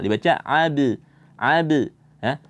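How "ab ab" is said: "ab" with a light, unrounded rebound on b; thin bounce. Shown as a demonstration. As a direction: bounce the b of "ab" into a slight neutral vowel. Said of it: "Dia tipis".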